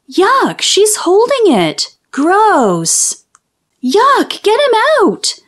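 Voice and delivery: high, squealing storybook panic; disgusted outcries. A narrator's reading. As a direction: cry out high, climbing in alarm. Children shriek at the held spider.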